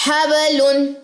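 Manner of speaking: normal talking voice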